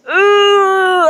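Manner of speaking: high, loud, sustained "ooh"; drawn-out ooh. Real, not acted. Reacting to a hard truth, a long loud high ooh.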